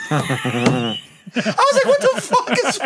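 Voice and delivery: can barely get it out for laughing; laughing hard. Real, not acted. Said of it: "I almost lost my shit".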